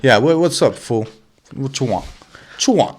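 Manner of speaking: loud high street swagger; street tough. Pretend. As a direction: bark it like a corner tough. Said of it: "that attitude".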